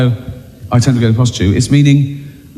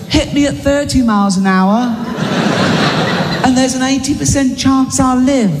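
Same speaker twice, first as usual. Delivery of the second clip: ad voice; 9 semitones higher than usual. Retold or imitated. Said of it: "Like that one".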